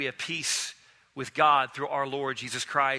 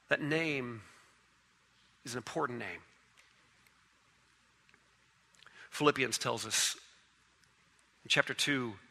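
hushed closing; 4 semitones lower, 8 dB quieter than usual. Acted. Preacher closing in reverence, soft and low. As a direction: let it settle into reverent stillness. Quiet closing over music.

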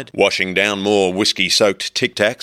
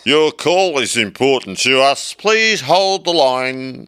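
slow, higher recorded voice; mock hold message; 5 semitones higher, slower than usual. A narrator's reading. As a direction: fake phone-system message.

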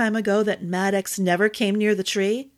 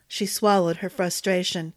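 ordinary delivery

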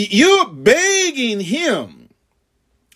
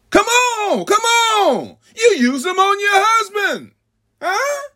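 preaching exhortation; 10 semitones higher than usual. Acted.

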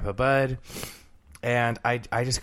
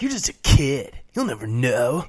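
put-on nineties teen bully; 2 semitones higher, 4 dB louder, slower than usual. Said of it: "older brother's a-hole best friend".

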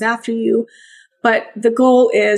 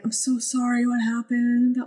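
softer, cooing mock sympathy; coddling; 6 dB quieter than usual. Owner bending down to a hesitant dog, coddling. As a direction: fuss over the poor dog in a hushed voice. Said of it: "If you coddle".

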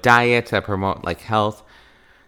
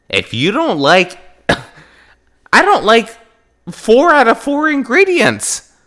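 much higher, pitched-up exasperated protest; exasperated squeal; 12 semitones higher, 3 dB louder than usual. Real, not acted. Explaining to listeners she dislikes every sushi ingredient, high pitched. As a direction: squeak it out in disbelief.

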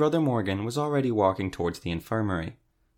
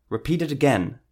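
raised, louder, higher order; urgent command; 5 semitones higher, 5 dB louder than usual. A narrator's reading. Cleric urges the sick woman on, raised commanding voice. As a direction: press them to repeat it, forcefully. Cleric urging her on, voice rising.

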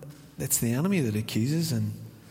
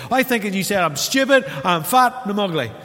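higher, louder, stung and upset; aggrieved outburst; hurt accusation; 6 semitones higher, 8 dB louder than usual. Retold or imitated. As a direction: blurt out the insult you heard. Hurt person says what they heard.

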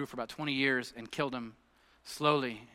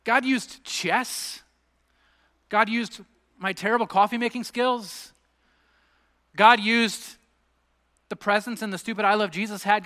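raised, emphatic preacher's voice with big pauses; climactic preaching; 7 semitones higher, 8 dB louder, slower than usual. Real, not acted. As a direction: build the refrain out to the congregation. Preaches climax at full force.

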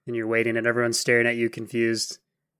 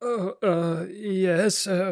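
high, put-on character voice; high-pitched, hesitant vendor; flustered merchant; 8 semitones higher, much slower than usual. Game master voices Digsby.

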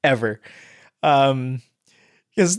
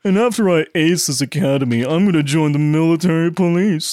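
higher, posh, pretentious cadet; Jean Kirstein impression; 3 semitones higher than usual. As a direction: quote the abridged Jean smugly. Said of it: "Attack on Titan abridged".

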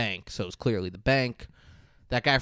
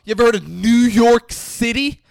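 much higher and louder sneering question; mocking snooty tone; 11 semitones higher, 13 dB louder than usual. Host mocking the agent's condescending question, loud sneer. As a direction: ham up the snob.

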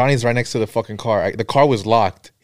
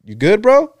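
high, louder you-good; high concerned question; concerned check-in; 10 semitones higher, 5 dB louder, slower than usual. Retold or imitated. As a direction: call out with sudden worry. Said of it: "And then I'm like".

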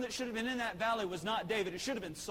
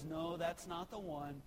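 quiet voice of God; 5 semitones lower, 6 dB quieter than usual. Retold or imitated. God answers Samuel.

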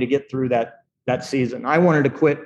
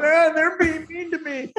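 much higher, laughing mock whine; mock whining; 13 semitones higher than usual. Staff writer whining to his boss, mock sniveling. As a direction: ham up a sniveling complaint. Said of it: "this staff writer going".

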